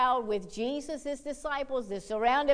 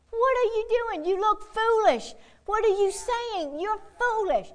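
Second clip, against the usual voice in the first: mocking crowd; 8 semitones higher than usual. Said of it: "crowds of people begin to say".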